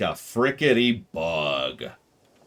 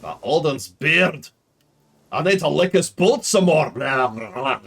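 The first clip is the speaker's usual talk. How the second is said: high, loud, exaggerated character voice; hammed-up character quote; 5 semitones higher, 5 dB louder than usual. Streamer quoting a character for viewers to guess, hammed-up. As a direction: ham it up, then dissolve into babble.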